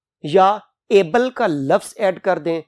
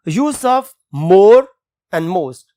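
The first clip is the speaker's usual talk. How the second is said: very slow, louder heading; announcing a heading; 7 dB louder, much slower than usual. Shown as a demonstration. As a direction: call out the new heading slowly. (धीमा, ज़ोरदार शीर्षक)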